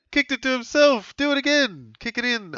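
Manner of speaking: rising high and loud, egging the player on; excited urging. Real, not acted. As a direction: cheer him to kick it in.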